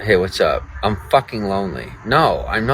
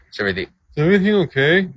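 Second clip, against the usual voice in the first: mocking chat question; 8 semitones higher, 5 dB louder, faster than usual.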